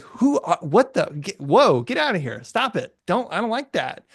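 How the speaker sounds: startled friend. Retold or imitated. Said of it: "your friend's gonna be like".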